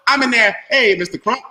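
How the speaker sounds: normal talking voice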